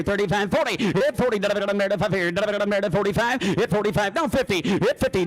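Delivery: fast, high, loud auction chant with filler syllables; auctioneer. Shown as a demonstration. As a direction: rattle off the bids like a live auctioneer. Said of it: "I have three items I'm gonna be selling to ya".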